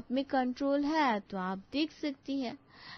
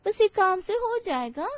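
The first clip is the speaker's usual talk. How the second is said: surprised; 7 semitones higher, 6 dB louder than usual. Acted. Surprised at easy advice.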